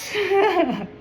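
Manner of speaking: a high laugh of disbelief; high incredulous laugh. Real, not acted. Laughing at the amazing news.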